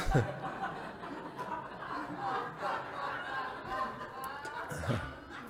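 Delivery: wheezing high laugh at his own joke; high breathless laugh. Real, not acted. Preacher cracking up at his own punchline, wheezing laugh. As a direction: let the laugh squeak out high and silent.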